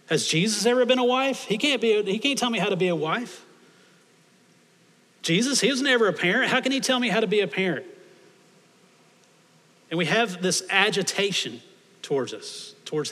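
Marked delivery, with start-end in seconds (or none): 0.1-7.9 mock indignation. Pastor mimicking a churchgoer offended by Jesus, mock indignant. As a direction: play the offended believer, pushing up in pitch.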